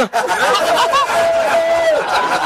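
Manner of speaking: high shrieking burst of laughter. Real, not acted. Storyteller shrieks with laughter at the punchline. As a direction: howl with laughter at your own joke.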